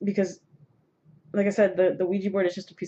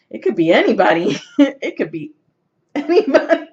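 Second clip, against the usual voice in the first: high, loud, laughing through the words; laughing disbelief; 8 semitones higher, 8 dB louder than usual. Retold or imitated. Telling viewers it could be any spirit, laughing loudly. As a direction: crack up while quoting your earlier self. Laughs while recalling her own reaction.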